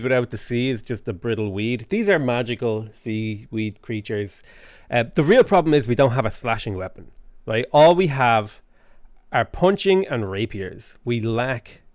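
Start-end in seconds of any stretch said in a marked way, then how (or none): none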